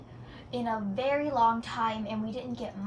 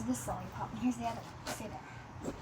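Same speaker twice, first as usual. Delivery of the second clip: whispered aside; 9 dB quieter than usual. Real, not acted. Aside while setting things down.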